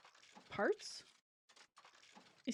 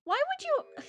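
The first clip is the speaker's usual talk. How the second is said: high character voice. Acted. Voicing character, high far.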